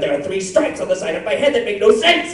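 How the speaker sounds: high, loud anime voice; high character voice. Acted. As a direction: shout it in a bright cartoon voice. Fan asks for character line.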